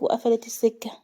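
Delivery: usual speaking voice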